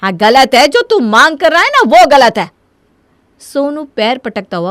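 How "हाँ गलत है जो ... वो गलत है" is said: loud, forceful comeback; angry retort. Acted. Mother turning son's complaint against him, angry retort. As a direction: throw the accusation straight back. Mother throws word back angrily.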